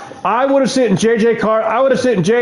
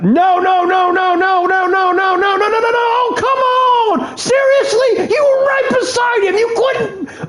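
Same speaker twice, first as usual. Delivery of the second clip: high, yelling disbelief at a teammate; frustrated outburst; 12 semitones higher than usual. Real, not acted. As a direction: scream at the screen in disbelief. Gamer raging at teammate's miss.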